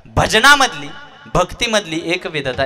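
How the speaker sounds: high ringing kirtan cry; full-voiced sermon call; preaching shout. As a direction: lift it out to the crowd. Kirtan preacher emphasis peak.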